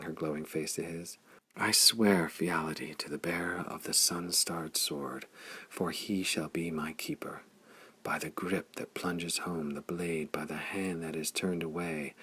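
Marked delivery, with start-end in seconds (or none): 1.6-7.4 quiet reverent oath; solemn oath. A narrator's reading. 8.0-12.1 quiet solemn recital; hushed oath. A narrator's reading.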